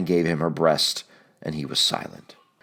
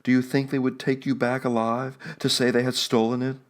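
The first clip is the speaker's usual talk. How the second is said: Juana higher, urgent and hoarse; hoarse cry; 7 semitones higher than usual. A narrator's reading. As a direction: cry it out hoarsely to her husband. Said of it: "she cried hoarsely".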